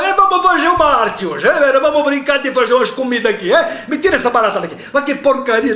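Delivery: put-on high Italian cook accent, laughing; crazy Italian chef with a heavy accent. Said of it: "eu botei um sotaque italiano nele".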